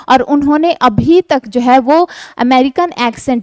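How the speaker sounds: ordinary talk, nothing marked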